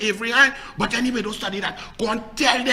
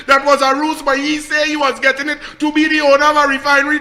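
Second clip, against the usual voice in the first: raised, high shouting rant; loud scornful rant; 4 semitones higher, 7 dB louder than usual. Real, not acted. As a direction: shout your ridicule without letting up. Ranting scornfully about Roger and refinery.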